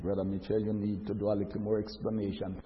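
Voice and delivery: very low, soft monotone; put-on low voice of an objector; interjecting listener.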